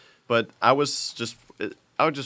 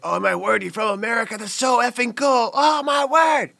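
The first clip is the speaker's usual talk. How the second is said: high-pitched squeal building to a shout; hammed-up starstruck British shriek; mock excited British kids; 12 semitones higher, 5 dB louder than usual. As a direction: gush louder and higher, imitating overexcited kids. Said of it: "they absolutely go insane".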